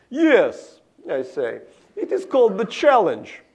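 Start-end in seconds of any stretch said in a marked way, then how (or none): 0.1-0.8 put-on short eager yes in a Russian accent; a Russian boy answering; young Gary's Russian accent. Boy answering his teacher, Russian-accented yes. 2.0-3.4 put-on proud and louder, Russian-accented; young Gary's Russian accent. Boy telling teacher his novel's title, louder Russian accent.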